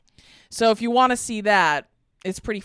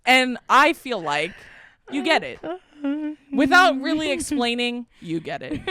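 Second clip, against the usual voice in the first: Jeremiah impression; 5 semitones higher than usual. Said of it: "this is my impression of Jeremiah".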